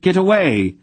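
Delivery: high, loud cry; shouted warning. A narrator's reading. Aunt Paul cries out for the smith to get away, high and loud. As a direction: yell at him to get back.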